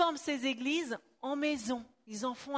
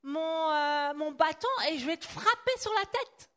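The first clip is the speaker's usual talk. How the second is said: higher-pitched mock threat; acted threat; 4 semitones higher, 4 dB louder than usual. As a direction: threaten them with a raised, emphatic voice. Interpreter re-enacts the threat with stick.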